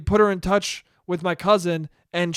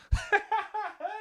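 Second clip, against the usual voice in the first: soft, high giggle; quiet real chuckle at own joke; laugh; 14 semitones higher, 8 dB quieter than usual. Real, not acted.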